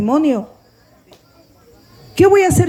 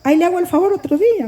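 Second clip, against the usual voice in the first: quick, higher mock promise; imitated brush-off; 5 semitones higher, faster than usual. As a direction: mimic someone grandly offering a favor for later. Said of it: "Porque oigan cómo me dicen".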